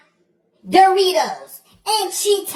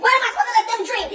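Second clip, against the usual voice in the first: ordinary talk, nothing marked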